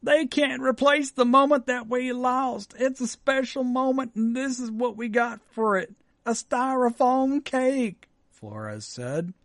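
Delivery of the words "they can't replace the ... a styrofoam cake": higher, slow, put-on Texan accent; upset Texan mom. Host voices the humiliated mom in a Texan accent. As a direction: whine it like the let-down mom in Texas.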